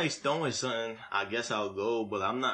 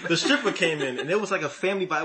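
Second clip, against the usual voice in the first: laughing; 7 semitones higher, 8 dB louder than usual. Real, not acted. Laughing while telling strip party story.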